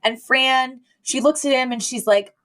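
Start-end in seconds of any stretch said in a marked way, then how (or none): none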